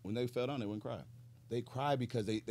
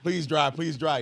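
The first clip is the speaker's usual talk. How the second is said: 1 mock pleading; 6 semitones higher, 10 dB louder, slower than usual.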